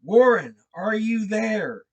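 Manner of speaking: high, loud call into the receiver; loud high call down the line. Acted. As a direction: call down hoping for an answer. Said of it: "Again I called down".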